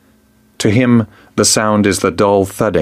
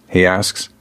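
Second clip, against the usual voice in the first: usual speaking voice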